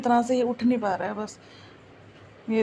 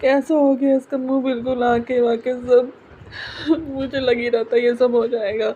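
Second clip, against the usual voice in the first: real tearful grief, raised voice; grief-stricken; 5 dB louder than usual. Real, not acted. Vlogger telling viewers her dog has died, grief-stricken. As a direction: choke out the news through sobs.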